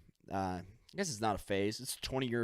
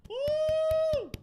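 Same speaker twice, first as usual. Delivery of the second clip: a much higher, wild whoop; cheer; 6 dB louder than usual. Pretend. He cheers for Therese, with a high wild whoop. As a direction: whoop like a crowd applauding. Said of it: "round of applause for Therese".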